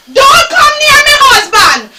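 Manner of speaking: mock stern shout; angry warning. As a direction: keep him away from the body. Widow warning son off.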